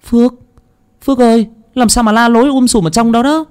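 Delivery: high, worried female voice calling in; older sister calling in, alarmed. Acted. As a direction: call out in a panic to her brother. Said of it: "vẻ mặt hốt hoảng".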